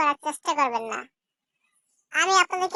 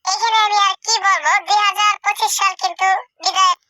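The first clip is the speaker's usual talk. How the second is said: higher, louder processed voice; voice-changer playback, pitched up and loud; changed app voice; 4 semitones higher, 7 dB louder than usual. Shown as a demonstration. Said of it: "দুই নম্বর যে ভয়েসটা আছে". (অ্যাপে বদলানো চড়া, জোরালো কণ্ঠ)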